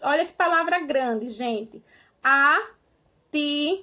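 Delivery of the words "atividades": "Atividades" slowly split into five separate syllables. Shown as a demonstration. Teacher counting mouth openings for pupils, "Atividades" syllable by syllable. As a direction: say "Atividades" in five beats, a clear pause between each. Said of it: "Eu abro a minha boca cinco vezes".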